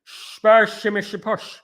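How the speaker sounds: mock Dutch accent, slushy "sh" for every s. Shown as a demonstration. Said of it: "That's a Dutch accent".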